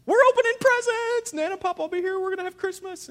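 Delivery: put-on very high, loud kid squeal; hammed-up excited child. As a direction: imitate a child bursting with Christmas excitement. London excitedly announces Christmas presents.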